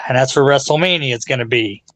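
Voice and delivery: ordinary talk, nothing marked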